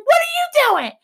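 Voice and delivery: very high incredulous question; mock high squeal. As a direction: squeak it in disbelief. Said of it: "I'm like".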